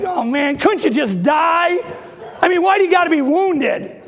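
high, loud exasperated whine at the mouse; exasperated plea. Retold or imitated. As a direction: beg the mouse to just die, half laughing. Pleading with trapped wounded mouse.

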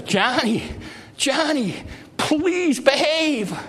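high, slow pleading mother's plea; begging mother. Retold or imitated. Mother begging her screaming son in the toy department, high and pleading. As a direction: beg the child like a worn-out mom. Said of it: "His mother was standing there begging him".

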